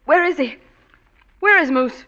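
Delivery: loud, sharp alarmed questions. Acted. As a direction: snap to fear. Sudden alarm hearing Moose.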